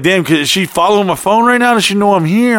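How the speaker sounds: high-pitched retold panic; freaking out. Man hiding in the camper, mildly panicked. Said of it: "I'm, I'm freaking out".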